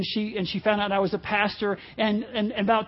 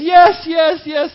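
loud, high, urgent repeats; mock-desperate, much louder and higher; frantic acted yes; 6 semitones higher, 10 dB louder, much slower than usual. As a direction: imitate a distraught woman accepting help. Acting out the distraught woman's answer.

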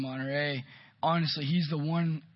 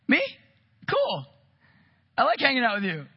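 much higher, startled then happy; a touch of bashful delight; surprised and pleased; 7 semitones higher, 3 dB louder than usual. Retold or imitated. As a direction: intern picked by Matt, surprised. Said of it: "I saw on Lucas's face".

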